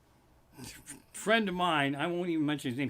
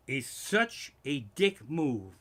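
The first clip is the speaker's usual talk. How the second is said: ordinary delivery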